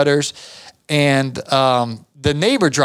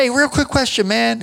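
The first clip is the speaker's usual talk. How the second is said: higher, breezy favor ask; casual boss; 7 semitones higher than usual. Retold or imitated. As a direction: boss sweet-talking his worker into a quick job, higher. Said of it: "he's like".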